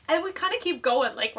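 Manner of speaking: ordinary talk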